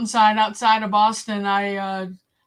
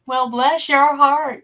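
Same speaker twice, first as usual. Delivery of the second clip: higher, louder, drawn-out Southern drawl; mock Southern accent; 5 semitones higher, 6 dB louder, slower than usual. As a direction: put on a syrupy slow Southern accent. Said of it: "they all talked funny".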